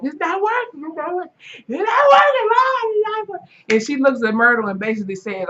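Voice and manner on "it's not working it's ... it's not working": high, mock crying shout of it's not working; mock crying wail. Cordelia pleading over dying Mallory, hammed-up crying wail. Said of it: "still crying and saying".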